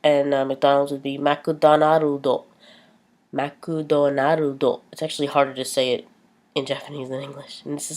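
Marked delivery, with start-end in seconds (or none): 1.2-2.4 McDonaldo said as makudonarudo, Japanese style; Japanese accent. Shown as a demonstration. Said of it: "say them like a Japanese person would". 3.3-4.8 McDonaldo drawn out very slowly as makudonarudo; Japanese accent. Shown as a demonstration. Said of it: "say them like a Japanese person would".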